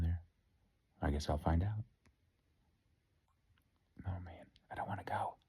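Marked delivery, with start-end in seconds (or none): 4.1-5.4 scared whisper before surgery. Real, not acted. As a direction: breathe the words, barely voiced.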